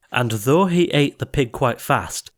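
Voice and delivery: plain reading voice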